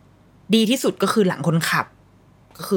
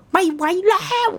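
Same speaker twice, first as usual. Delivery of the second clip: imitated nonstop crying; 13 semitones higher than usual. Mother imitating her baby stuck at a red light, mock wailing. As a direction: wail on and on like an inconsolable baby. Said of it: "ร้องแบบ ร้องไม่หยุด".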